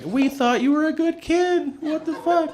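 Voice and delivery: very high, hurt parental voice; imitated dismayed parents. Parents looking at overdosed kid, imitated high-pitched dismay. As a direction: play the parents aghast in a high voice. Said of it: "they look so just like".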